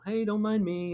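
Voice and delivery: high-pitched voice of a passer-by; casual passer-by. Retold or imitated. Passer-by excusing themselves to a painter, high light voice.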